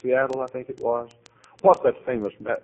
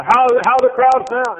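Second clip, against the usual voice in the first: eager question; 13 dB louder than usual. Retold or imitated.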